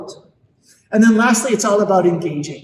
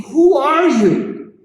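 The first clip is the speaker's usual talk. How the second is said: slow, high, louder disbelief; incredulous; 6 semitones higher, 4 dB louder, much slower than usual. Retold or imitated. Speaker recalls her inner reaction to the parents, slow and incredulous. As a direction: drag out who in stunned disbelief. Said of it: "And I'm thinking".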